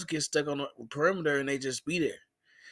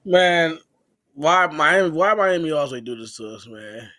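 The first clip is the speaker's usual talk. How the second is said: louder, venting frustration at Miami; exasperated outburst; 7 dB louder, slower than usual. Real, not acted. Fan venting to the host about Miami, loud and exasperated. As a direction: let it burst out, fed up.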